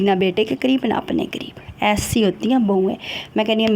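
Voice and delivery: usual speaking voice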